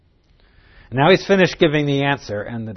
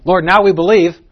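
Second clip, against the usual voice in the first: higher, louder mock-sure disciple; confident disciple; 6 semitones higher, 8 dB louder than usual. Thomas to the Lord, claiming belief with mock certainty. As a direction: play the overconfident believer. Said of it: "He's one of those sitting here saying".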